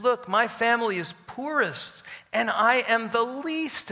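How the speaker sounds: high, loud plea of unworthiness; pleading protest. Acted. Gideon protests God's call with excuses.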